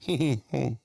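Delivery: mock giggling noise from the stranger; a hint of a weird chuckle; imitated odd laugh. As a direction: make his strange little laugh. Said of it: "the fucking sound he made".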